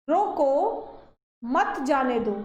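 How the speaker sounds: रोको held apart by a long pause before मत जाने दो; pause after रोको. Shown as a demonstration. Teacher reads the first punctuation example, pause after रोको. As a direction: say रोको, stop for a beat, then मत जाने दो. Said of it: "रोको के बाद कुछ सेकंड के लिए रुके".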